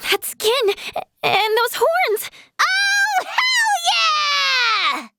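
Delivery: awed, high voice building to a long squeal; thrilled discovery. Acted. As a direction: build from amazement into an elated shout. Spots the sought rarity, celebrates.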